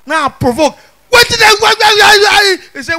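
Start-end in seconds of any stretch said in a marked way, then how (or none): 1.1-2.6 much higher, pleading protest; exasperated why. Retold or imitated. Protesting to the boy who mashed his foot. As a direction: demand why, voice climbing.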